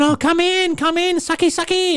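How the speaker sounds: very high, loud put-on female voice; mock come-on in a squeaky falsetto; luring women. Imitates brothel women luring him in.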